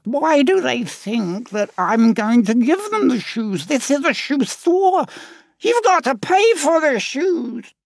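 high, loud, slow indignant squawk; Arturo the parrot. Acted. Parrot protests the birds want free shoes, indignant squawk. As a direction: insist they pay, a stern shopkeeping parrot. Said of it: "but thinks".

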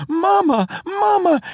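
high, loud frightened call; crying for mamma. A narrator's reading. Girl about to be swallowed calling her mother, high and loud. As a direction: cry out in terror.

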